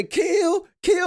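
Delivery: high, loud "kill, kill" chant; retold yelling. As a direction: mimic the frenzied kill chant. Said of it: "jumping up and down, yelling".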